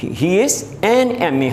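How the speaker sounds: slow, careful he is an M A; slow demo. Shown as a demonstration. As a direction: spell it out slowly for students. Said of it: "ہم کہیں گے". (آہستہ آہستہ سمجھاتے ہوئے)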